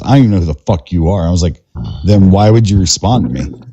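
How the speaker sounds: ordinary delivery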